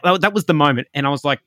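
ordinary talk

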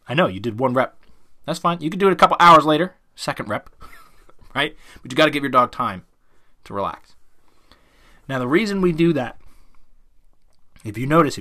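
usual speaking voice

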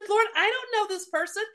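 telling the Lord she doesn't know the soldier; protesting to God. Retold or imitated. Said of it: "I said".

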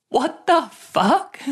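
high, slow, stunned what-the-fuck; drawn out in total disbelief; shocked disbelief. Retold or imitated. Told she's being dumped, high slow stunned disbelief.

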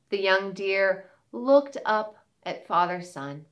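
plain reading voice

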